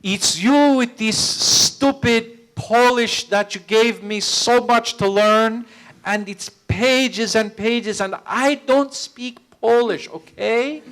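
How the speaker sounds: high, slow whiny French actress voice; imitating the actress. Actress moaning to the director about her Polish lines, high and slow. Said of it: "she'd be like this".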